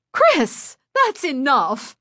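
high, scolding protest; angry cry. A narrator's reading. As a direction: cry out to stop a misbehaving pupil. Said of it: "Missus Berman cried".

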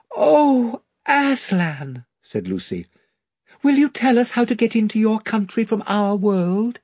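put-on high awed gasp of a girl; Lucy overwhelmed at the sight; Lucy cries out to Aslan in awe; awed cry. A narrator's reading. Lucy sees Aslan appear.

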